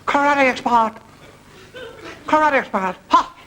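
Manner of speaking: mock karate expert. Karate shout at dog.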